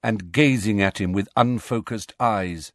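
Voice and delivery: ordinary delivery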